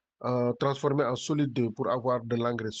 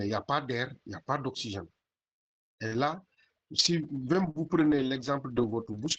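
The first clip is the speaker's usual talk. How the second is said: ordinary talk, nothing marked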